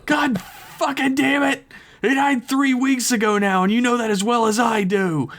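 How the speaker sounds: high-pitched furious scream. A narrator's reading. Jason screaming at Rio about the timeline, furious high scream. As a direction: scream it as an outraged tirade. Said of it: "Jason screamed by".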